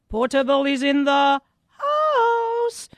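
slow, drawn-out radio hype call; hype shout-out. Acted. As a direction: stretch out the house like a DJ. Host hyping a listener's call-in.

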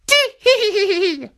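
tittering high-pitched cackle; high old woman giggle. Acted. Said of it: "laughed the old woman".

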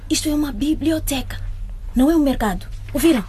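stern scolding. Acted. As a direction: scold them sharply. Librarian scolds noisy girls.

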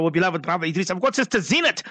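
ordinary delivery

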